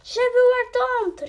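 high, loud call. A narrator's reading. The maid calls out for the princess, high and loud. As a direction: call out across the palace. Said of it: "riep de meid".

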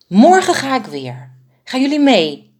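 high, loud, eager call; eager invitation. A narrator's reading. The smallest bird eagerly invites the fearful flock, high and loud. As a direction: sweep them along with excitement.

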